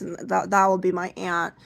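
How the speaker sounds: usual speaking voice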